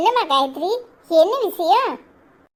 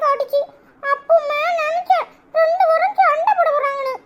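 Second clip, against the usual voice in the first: put-on much higher, eager kid telling tales; child voice; 11 semitones higher than usual. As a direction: blurt out the family secret like a small child. Child tattles on parents. (ரகசியம் சொல்லும் சின்னப் பிள்ளைக் குரல்)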